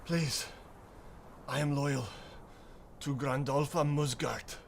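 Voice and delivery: mild pleading. Acted. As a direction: plead your loyalty in short, breath-broken pieces. Surrendered captive pleads his loyalty.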